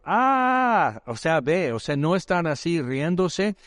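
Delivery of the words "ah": long high wail, acted; drawn-out high "aah" of mock torment; agonized cry. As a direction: imitate a demon crying out in agony. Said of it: "dio voces el hombre diciendo".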